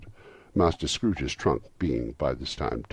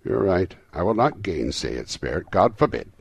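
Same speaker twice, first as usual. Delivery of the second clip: raised voice, emphatic agreement; earnest cry; 4 semitones higher, 7 dB louder than usual. A narrator's reading. Scrooge agreeing fervently with the Spirit, crying out. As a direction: cry it out, louder and higher at the start and end. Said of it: "cried Scrooge".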